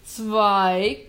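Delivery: very loud screamed count; shrieked. Acted. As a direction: scream it at full volume. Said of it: "kreischte der Jäger".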